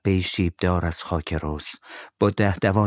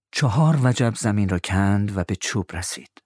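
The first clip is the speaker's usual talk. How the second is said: ordinary delivery, nothing marked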